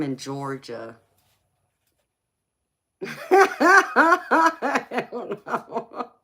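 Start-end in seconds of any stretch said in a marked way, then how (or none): 3.0-6.1 high, drawn-out laugh through the words. Real, not acted. Laughing at chat comment.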